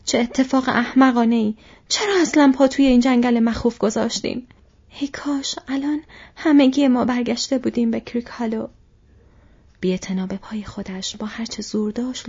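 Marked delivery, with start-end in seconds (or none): 0.0-8.7 louder, higher, frantic lament; frantic cry. A narrator's reading. Frodo rueing the forest to his companions, crying out frantically. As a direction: cry out wildly in regret.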